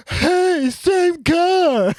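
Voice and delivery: high, loud, far-off exaggerated copy; mocking parrot voice. Sarah Silverman echoing the driver in a mocking high voice. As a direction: echo the guy back in a silly high voice. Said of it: "And she goes".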